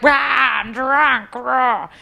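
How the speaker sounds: hammed-up drunken holler; mock drunken roar. Imitates her own drunken roaring.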